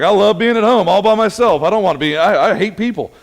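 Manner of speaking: loud, high mock gush about loving solitude; teasing impression of a happy recluse; mock homebody. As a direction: ham up the loner who hates people. Mocking friends who love solitude.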